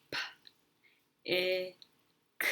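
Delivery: each sound of "P, I, K" said separately with a pause between. Shown as a demonstration. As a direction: say "P, I, K" as three isolated phonics sounds, not letter names. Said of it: "write while saying the sounds".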